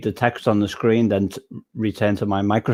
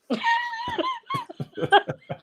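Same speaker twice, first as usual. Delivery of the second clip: big laugh; 7 dB quieter than usual. Real, not acted. Laughing at being asked for an accent.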